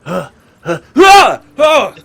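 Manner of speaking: grunts building to loud, high groans; pained gasps. Acted. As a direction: groan after a hard fall. Fallen man gasping on landing.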